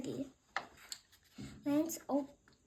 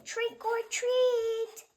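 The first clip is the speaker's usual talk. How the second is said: high, louder, slow call; drawn-out louder call; louder slow trick or treat; a little louder; 10 semitones higher, 6 dB louder, much slower than usual. Acted. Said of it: "He said a little louder".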